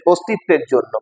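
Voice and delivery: normal talking voice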